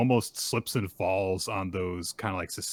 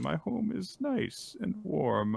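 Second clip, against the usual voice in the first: dreamy, doddery reminiscing, pitched up; wistful old man; 10 semitones higher than usual. Acted. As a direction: play a confused old man longing for home. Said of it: "He's just like".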